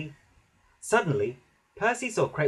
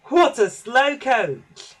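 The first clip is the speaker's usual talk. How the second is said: high, loud, drawn-out taunt; slow teasing shout; children's jeer; 11 semitones higher, 9 dB louder, much slower than usual. A narrator's reading. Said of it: "they cried".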